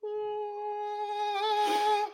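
long, high, soft sustained note; drawn-out high "ah". Retold or imitated. Recalling pulling out the tape, awed high "ah". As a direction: softly hold a high "ah". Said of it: "you're like".

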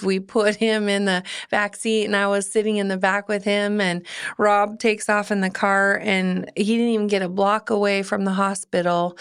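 normal talking voice

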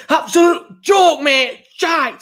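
high, loud, slow mock furious fan rant; hammed-up outraged supporter; angry fan. Podcast host imitating an angry fan reaction, mock outrage. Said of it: "it goes from".

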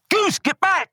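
high-pitched warning shout, acted out; imitated yell. Buddy warns him off the highway, yelling. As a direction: mimic a buddy's urgent yell. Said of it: "one of my buddies yells".

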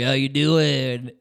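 drawn-out knowing hello; hammed-up flirty coworker; mock suggestive greeting.

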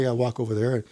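ordinary talk

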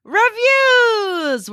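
sung high and held; high drawn-out word. Acted. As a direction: hold the word high and long. Finishing the show title.

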